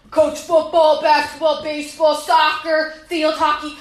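loud, punchy rattle of sports; mock-impressed loud listing; shouted list. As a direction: bark each sport out.

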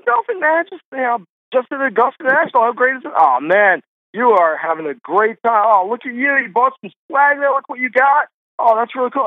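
high-pitched, gushing fan chatter; imitated chummy small talk. Friend chatting with a stranger at Augusta, high mock enthusiasm. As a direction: mimic two instant pals gushing.

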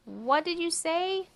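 high, slow rising question; cricket who can't hear. Acted. Cricket can't hear the mouse, puzzled high call. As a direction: call back over your own chirping.

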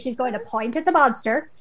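ordinary talk

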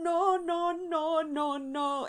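high whining oh no, fading; mock crying oh no. Quoting a TikTok audio clip, a high whimpering oh no. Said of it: "TikTok audio of".

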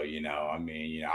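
quiet, deep detective murmur; Columbo impression. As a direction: play the harmless, bumbling detective. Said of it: "you love my Columbo".

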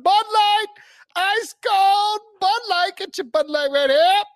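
very high, loud drawn-out beer vendor call; stadium vendor hawking. Retold or imitated. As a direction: bellow it across the stands like a beer vendor. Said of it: "That's a pretty good vendor call".

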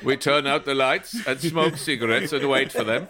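laughing hammed-up Eastern European accent; comic Czech coach voice, laughing; mock Eastern European accent.

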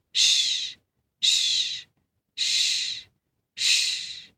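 repeated unvoiced shh; whispered shh. Two men tiptoe past a sleeping lion, hushing.

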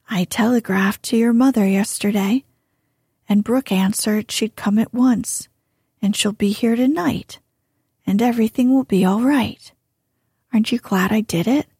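fast, eager rush of words; spoke very fast. Acted. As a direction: blurt out the secret quickly. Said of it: "Laurie spoke very fast".

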